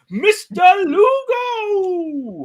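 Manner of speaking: announcer call. Real, not acted. Announcing a guest on stream.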